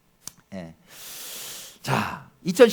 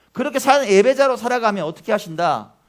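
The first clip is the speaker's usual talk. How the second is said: ordinary delivery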